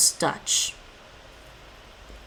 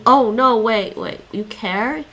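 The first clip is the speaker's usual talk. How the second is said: ordinary delivery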